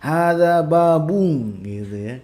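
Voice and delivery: babung ending in ng, slow; teasing Sulawesi-style babung. Retold or imitated. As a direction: imitate the Sulawesi n-to-ng habit. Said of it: "kalau ada N di ujung itu jadi eng".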